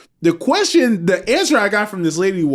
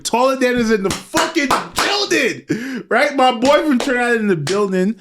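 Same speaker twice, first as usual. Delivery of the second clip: hype announcement; 6 semitones higher than usual. Real, not acted. Hyping guest's arrival.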